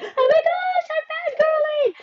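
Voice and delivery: very high-pitched gushing squeal; excited fangirl squeal. Acted. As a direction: squeal it like a starstruck fan. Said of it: "usually it's me that I'm like".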